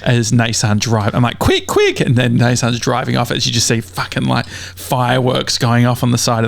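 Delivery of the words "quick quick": very high, squeaky urging; high-pitched voice. Retold or imitated. Passenger filming as the driver pulls away, high-pitched urging. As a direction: shoot right up high, imitating your own excited voice. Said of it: "laughing in my high-pitched voice".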